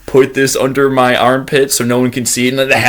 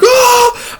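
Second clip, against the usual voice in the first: high short yelp; scared scream. Retold or imitated. Fleeing hiker yelping in fear. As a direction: yelp in fright.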